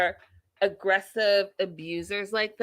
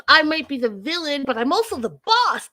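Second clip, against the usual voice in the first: high, loud, hammed-up comeback; mock defiant boast; 8 semitones higher, 5 dB louder than usual. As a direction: crow it, stretching the last phrase. Acting out Angela's defiant comeback.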